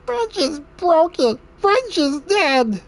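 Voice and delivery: grieving Smeagol goblin. Acted. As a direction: moan it as a heartbroken little goblin. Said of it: "bring out my Smeagol Goblin".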